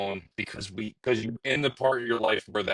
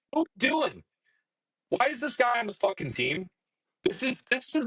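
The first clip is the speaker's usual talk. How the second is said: much higher, cracking with exasperation; exasperated rant; 11 semitones higher than usual. Real, not acted. Fan ranting about the Bruins roster, exasperated and high. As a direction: vent it like a fan who can't believe it.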